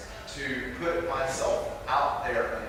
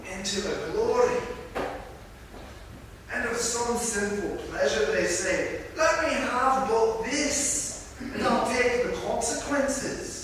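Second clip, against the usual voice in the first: reading the Spirit in a hammy, higher Scottish brogue; bad Scottish accent; 5 semitones higher than usual. Preacher reading the Spirit's words to the congregation in a bad Scottish accent. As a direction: lift the pitch and roll it out like a bad Scottish impression. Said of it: "do a really bad Scottish accent".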